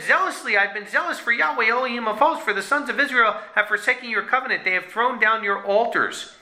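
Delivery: high-pitched, impassioned complaint; zealous protest. Acted. Eliyahu protests to Yahweh about forsaken covenant, zealously. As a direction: plead the case high, easing down gradually.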